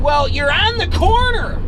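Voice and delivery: high, loud exasperated retort; yelling back. Retold or imitated. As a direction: shout the obvious back at him. Yelling back in retold quarrel.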